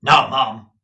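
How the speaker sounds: loud scornful uncle; scornful Ralph. A narrator's reading. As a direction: cut in with contempt. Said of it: "with such scorn".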